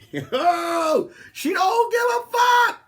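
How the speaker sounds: high and loud, hyped yelling; excited shout. Retold or imitated. Hyped comedian yelling to the crowd about the girl.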